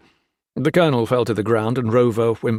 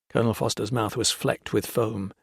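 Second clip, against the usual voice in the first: usual speaking voice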